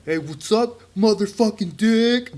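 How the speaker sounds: high, drawn-out playful holler; brash mock greeting. Kindergartner greeting a classmate, brash mock holler. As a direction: call it out high and cocky, stretching the insult. Acts himself cussing in kindergarten.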